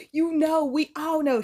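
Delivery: ordinary talk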